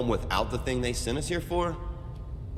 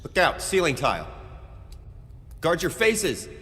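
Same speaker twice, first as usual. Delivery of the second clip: urgent, raised combat warning; shouted warning; 8 semitones higher than usual. Acted. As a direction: call out danger to the squad. Warning shouted during an attack.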